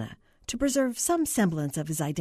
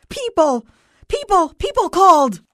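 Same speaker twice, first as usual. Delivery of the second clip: high, very loud frantic cries; distraught, full-force shouts; hysterical shouting; 12 semitones higher, 13 dB louder than usual. A narrator's reading. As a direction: scream each word at the clerk. Hysterical caller shouts back.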